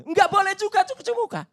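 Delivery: high-pitched imitated refusal; larangan petugas. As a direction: mimic an officer forbidding it outright. Petugas menolak permintaan cuci muka. (meniru larangan petugas, nada tinggi)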